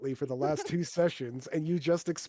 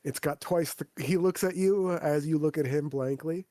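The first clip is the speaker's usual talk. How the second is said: ordinary talk, nothing marked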